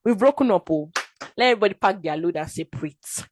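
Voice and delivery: loud, defiant break-up with God; fighting with God. Retold or imitated. Angry believer breaking up with God, loud and defiant. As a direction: shout it like ending a relationship. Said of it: "I fought with God".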